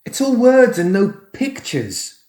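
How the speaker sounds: put-on high, slow scolding little boy; scolding child. Boy scolding Edmund about the papers, high and slow. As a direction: scold like a spoilt small boy. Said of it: "the kid scolded".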